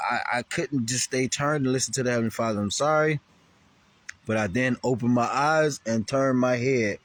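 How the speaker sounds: normal talking voice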